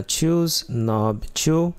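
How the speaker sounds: ordinary delivery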